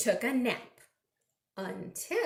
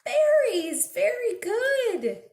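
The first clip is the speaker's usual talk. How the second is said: much higher and louder praise; excited reveal; 11 semitones higher, 6 dB louder than usual. Acted. Praising children's guess, excited. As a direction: cheer the answer.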